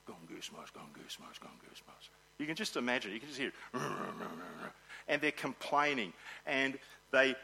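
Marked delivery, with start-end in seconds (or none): 0.1-2.1 fading to a whispered mumble; grumbling mutter. Retold or imitated. Preacher imitating grumbling workers, muttered whisper. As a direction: mutter it under your breath, softer each time. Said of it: "complaining and mumbling and saying".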